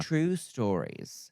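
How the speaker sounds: very slow, drawn-out words. Pretend. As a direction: stretch it out slowly. Quoting a catchphrase.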